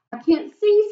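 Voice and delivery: a small frightened squeak; high worried snail. A narrator's reading. Snail lost in the dark seaweed, high and worried. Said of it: "said the snail".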